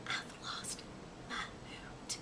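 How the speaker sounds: slow, breathless whisper. Acted. Owlet whispering her worry to the mouse. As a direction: whisper it like a lost secret. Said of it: "whispered Ola".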